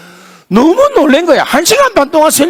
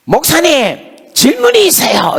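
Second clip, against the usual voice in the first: higher, drawn-out eager student voice; imitating a student; 9 semitones higher than usual. Student calling to the pastor after the lecture, eager high voice.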